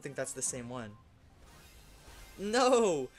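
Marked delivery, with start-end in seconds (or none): 2.4-3.1 high, loud regretful cry; despairing cry. Real, not acted. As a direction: cry out at your own mistake. Regret after leaving.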